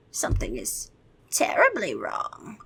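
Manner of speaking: vampire whispers that something is wrong; eerie whisper. Acted. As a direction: hush it like a terrible secret.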